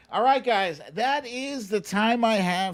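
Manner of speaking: upbeat sign-off. Real, not acted. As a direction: wrap up the show with energy. Signing off to viewers.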